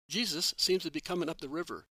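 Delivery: plain reading voice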